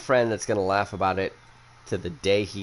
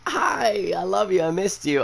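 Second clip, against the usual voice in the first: sweet greeting; 10 semitones higher, 3 dB louder than usual. Retold or imitated. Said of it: "just be like".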